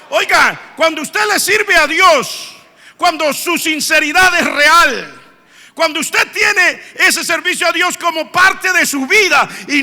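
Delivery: rousing preaching. Acted. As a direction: urge the congregation, rising to a shout. Preacher addresses the congregation. (exhortación enérgica a la congregación)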